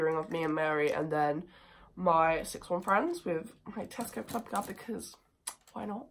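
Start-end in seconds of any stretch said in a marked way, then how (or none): none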